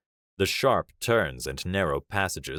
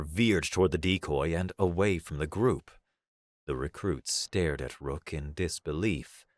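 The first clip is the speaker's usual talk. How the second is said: usual speaking voice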